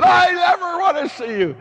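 loud, high yelling, stretching forever; furious shout. Retold or imitated. Priest yelling at a truant student, furious shout. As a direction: chase him out screaming. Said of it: "I said to this kid".

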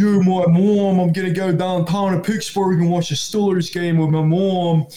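loud, high-pitched mock Pittsburgh accent; British hillbilly accent. Mimicking Pittsburgh locals going to a Steelers game, loud British hillbilly accent. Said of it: "they sound like a British hillbilly".

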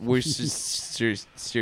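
Worcestershire trailing into a long hiss, very slow and soft; mangled pronunciation. Retold or imitated. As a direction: stretch Worcestershire into wirsssss. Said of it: "And now you got Worcestershire".

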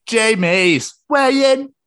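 high, loud, drawn-out announcement; segment announcer. Acted. Co-host launching the weigh-in segment, stretched loud announcer call.

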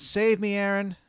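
high, loud desperate cry for help; a skull-faced ghost begging to be saved; ghostly plea. Acted. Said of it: "And she goes".

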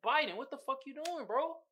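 mild mock outrage at the president; exasperated callout. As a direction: throw up your hands at Biden. Calling out Biden in disbelief.

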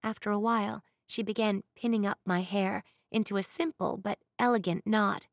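usual speaking voice

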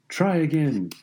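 understated narrated yell; mild yell. A narrator's reading. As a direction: urge her on from across the court. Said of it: "he yelled".

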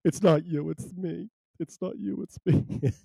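high-pitched mock breakup voice, about to laugh; hammed-up breakup, lifted pitch; mock breakup line. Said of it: "Father Brian's breaking up with me and just said".

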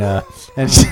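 usual speaking voice

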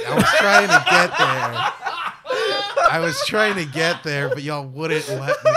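laughing too hard to defend yourself properly; laughing protest. Real, not acted. Co-host teased over a nine eleven slip, laughing protest. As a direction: protest through real laughter, starting high and loud.

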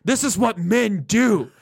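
high, pompous mock manly boast; mock macho bravado. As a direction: puff up like a proud caveman. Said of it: "they're like".

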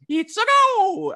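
very high, slow, hammed-up Mario cheer; high-pitched cartoon plumber shout, slow and drawn out. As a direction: stretch it out like the little plumber. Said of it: "the most Mario Kart had was".

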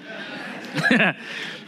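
soft unvoiced chuckle; breathy laugh. Real, not acted. Preacher laughing at his tax joke. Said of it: "laughs at his own joke".

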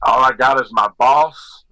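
Scarface quote. Retold or imitated. Quoting Scarface lines.

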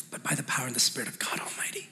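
sudden awed whisper of faith; hushed whisper. Acted. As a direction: drop to a reverent whisper. Climax of David's declaration.

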